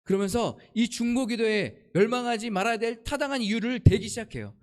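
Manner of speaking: ordinary talk, nothing marked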